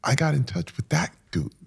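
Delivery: ordinary talk, nothing marked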